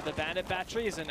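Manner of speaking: ordinary delivery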